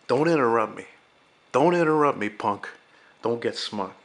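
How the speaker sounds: loud, sharp reprimand that rises on the repeat; snapping at someone. Retold or imitated. Darnell cuts off Arnie's interruption with a loud snap. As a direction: shut the kid down hard.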